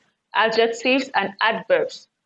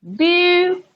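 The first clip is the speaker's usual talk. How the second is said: BEAU of beauty alone, high and drawn out; stressed first syllable alone; 8 semitones higher than usual. Shown as a demonstration. Teacher isolating the first syllable, Beau held high. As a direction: hold just Beau up high. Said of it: "first syllable".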